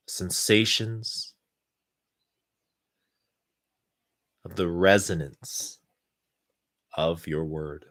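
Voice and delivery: calm, unhurried guidance with long pauses. Acted. Leads a guided meditation.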